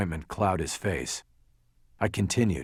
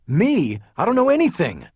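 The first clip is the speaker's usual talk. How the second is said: high, loud startled denial; indignant disbelief; 11 semitones higher, 7 dB louder than usual. A narrator's reading. Lawyer denies knowing anything to detective, indignant disbelief. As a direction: protest with the voice shooting up.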